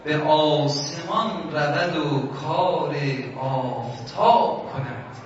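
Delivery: slow, drawn-out poetic recitation; slow verse recitation, falling at the end; recited verse. Retold or imitated. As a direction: recite the line slowly as a dream message. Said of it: "این مصرع رو بگو".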